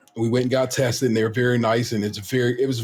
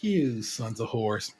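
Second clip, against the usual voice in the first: low, slow, softer grumble; muttered curse; 6 semitones lower, 7 dB quieter, much slower than usual. Retold or imitated. Dad at a closed drive-through, grumbling a slow low curse. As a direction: curse it under your breath. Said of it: "And I'm like".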